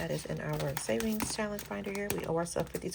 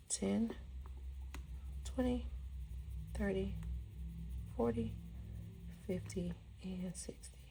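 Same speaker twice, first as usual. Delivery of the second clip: whisper; 8 dB quieter than usual. Real, not acted. Counting bills under breath.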